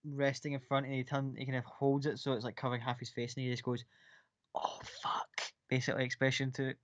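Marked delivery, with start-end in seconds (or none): 4.5-5.4 breathless whispered "oh, fuck"; whispered curse. Acted. Toto hiding behind the book, whispering a curse.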